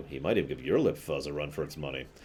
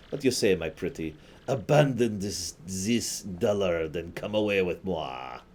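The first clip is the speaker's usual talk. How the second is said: put-on French accent. Sleazy Dupree sweet-talking the partner in a hammed-up French accent. French flirt reads lines.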